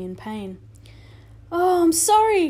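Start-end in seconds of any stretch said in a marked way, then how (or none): 1.5-2.5 high, loud cry of dismay; cried out. A narrator's reading. Boy cries out to wounded Indian, loud and high. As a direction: Omri cries out, upset. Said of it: "cried Omri".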